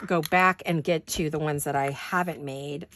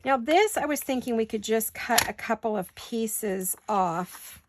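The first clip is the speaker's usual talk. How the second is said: ordinary delivery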